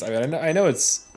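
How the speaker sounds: ordinary talk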